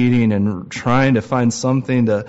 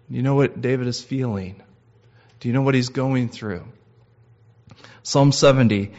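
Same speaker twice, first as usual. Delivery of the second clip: ordinary delivery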